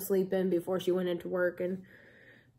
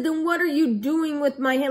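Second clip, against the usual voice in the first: mock yell, higher and louder; raised-voice retelling of an angry demand; retold growl; 6 semitones higher, 5 dB louder than usual. Patient confronting the nurse over her hand, retold growl. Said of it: "growling voice".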